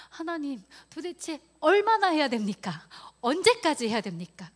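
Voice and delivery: loud, high plea to God; desperate outcry. Retold or imitated. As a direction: cry out in protest as if in exasperated prayer. Acts out crying out to God.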